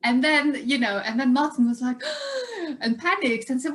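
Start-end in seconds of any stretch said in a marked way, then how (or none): 2.1-2.8 gasp. Retold or imitated. Martin shocked at dropped word.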